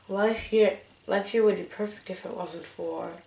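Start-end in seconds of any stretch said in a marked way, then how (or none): none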